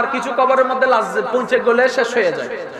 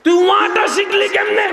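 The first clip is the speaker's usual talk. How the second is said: fiery preaching; 5 semitones higher than usual. Acted. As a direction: preach it with full fire to the crowd. Preaching at full force. (উচ্চস্বরে জোরালো ওয়াজ)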